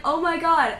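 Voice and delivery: mildly acted astonishment; shocked, retold. Retelling cashier hearing of a review, mock shocked. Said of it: "And she was so shocked".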